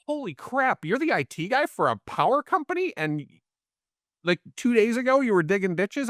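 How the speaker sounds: thinking it in open-mouthed amazement; retold inner disbelief. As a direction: let the disbelief lift your pitch right up.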